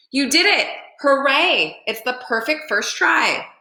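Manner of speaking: put-on great aunt cheering young Rosie's first flop; excited cheer. A narrator's reading. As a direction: cheer her on like a proud old aunt.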